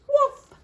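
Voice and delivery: high, yappy mock woof; playful dog bark. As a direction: bark like a happy puppy. Dog barks in story.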